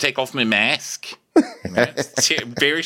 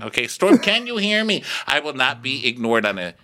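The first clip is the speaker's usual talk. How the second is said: ordinary talk, nothing marked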